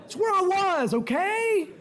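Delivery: laughing protest. Retold or imitated. Joking plea to teasing angels.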